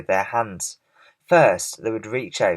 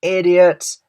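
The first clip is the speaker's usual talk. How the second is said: higher, loud insulting yell; 8 semitones higher, 8 dB louder than usual. A narrator's reading. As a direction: yell it across at them. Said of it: "he yelled".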